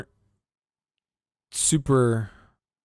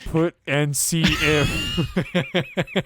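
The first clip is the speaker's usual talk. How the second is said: laughing; 5 semitones higher, much slower than usual. Real, not acted. Laughing while mocking.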